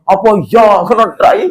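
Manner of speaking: high, incredulous scoff in mimicry; mock stupid arguer. Preacher mimicking a fool who rejects his proof texts, high mocking scoff. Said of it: "debat karo wong goblok".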